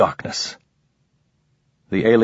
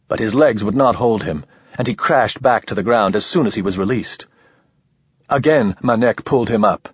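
ordinary delivery, nothing marked

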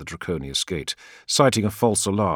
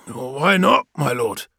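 Gargo; 7 semitones higher, 4 dB louder, slower than usual. Acted. Gargo questions the Primarch.

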